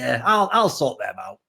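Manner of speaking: low, slow mock fixer assurance; slow shady promise. Retold deal pitch ending.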